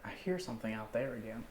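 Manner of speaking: hushed whisper, no voice. Real, not acted. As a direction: breathe it out softly. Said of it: "people can see you haven't whispered".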